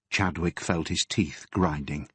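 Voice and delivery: usual speaking voice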